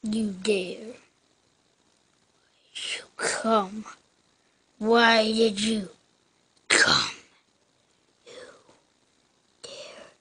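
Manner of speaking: hushed threat, low and dark; menacing villain. Pretend.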